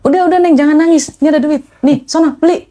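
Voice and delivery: high, insistent imitation of a helpful stranger; consoling older man. Stranger offers money to a crying rider, imitated urgent consoling. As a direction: urge them to stop crying. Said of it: "ada bapak-bapak yang bilang".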